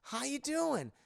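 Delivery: put-on high, cheery kid voice; bright little son greeting his dad; toy Max voice. Toy head speaking to Goofy as Max, high and cheery. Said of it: "and it goes".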